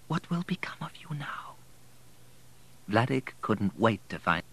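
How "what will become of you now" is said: soft breathy whisper to her son. Acted. Mother whispering to her son in an embrace, hushed. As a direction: breathe it into his ear. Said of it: "heard her whisper".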